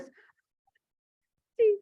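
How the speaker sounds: very high, drawn-out mock squeal; astonished squeal. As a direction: imitate a relative squealing in disbelief. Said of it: "My nephew starts screaming".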